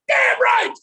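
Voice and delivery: high, loud shouted approval; triumphant sideline shout; cheer capper. Retold or imitated. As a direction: yell it to seal the chant. Capping the cheer.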